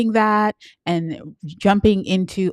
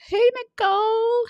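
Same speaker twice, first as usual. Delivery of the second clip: squealed greeting. Real, not acted. Greets a viewer in comments.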